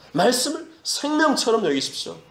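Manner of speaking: "말씀을 생명처럼" pitched high; emphatic repeat. Real, not acted. Preacher repeats exhortation more urgently.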